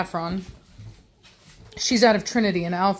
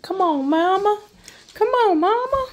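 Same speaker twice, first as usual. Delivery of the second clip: high squeaky puppy voice, much higher; voicing the puppy; 11 semitones higher than usual. Owner voicing a puppy begging its mother, squeaky and high. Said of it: "she's like".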